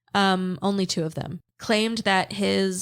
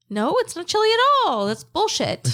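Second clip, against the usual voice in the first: high, loud, fast protest; indignant outburst; 11 semitones higher, 5 dB louder than usual. Real, not acted. Co-host rejecting the chilly-weather excuse, high indignant outburst. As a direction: burst out in exasperated disbelief. Ranting about Texas weather.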